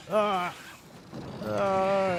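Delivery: high startled yelps; startled cry. Real, not acted. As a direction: yelp in sudden alarm. Sudden fright in game.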